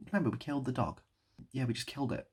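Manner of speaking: usual speaking voice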